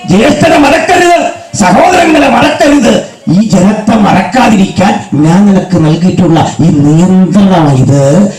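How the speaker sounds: high, full-voiced sermon climax; preacher lifting the congregation to a climax; fervent preaching.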